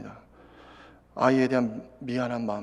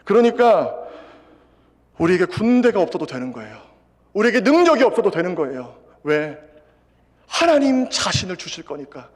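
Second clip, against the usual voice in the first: loud, raised preaching voice; fervent preaching; 5 semitones higher, 8 dB louder than usual. Acted. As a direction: urge the congregation with conviction. Preaching at full force. (확신에 찬 설교조로)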